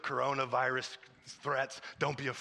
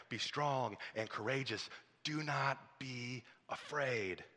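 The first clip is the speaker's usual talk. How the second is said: hushed, low and measured; soft, solemn comfort; quiet reassurance; 5 semitones lower, 6 dB quieter than usual. Real, not acted. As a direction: let it settle softly, with weighty pauses.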